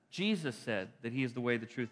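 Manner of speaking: usual speaking voice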